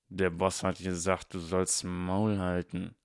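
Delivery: slow, grumbled thug voice; grumbling henchman. A narrator's reading. Henchman Crab grumbles at Harry to shut up. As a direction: grumble it like a dim bodyguard. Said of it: "grummelte Mister Crab".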